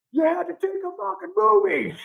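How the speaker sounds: high, loud, hammed-up furious dad; mock angry dad. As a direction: play the dad snapping at the camera. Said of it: "So mad that I had the camera".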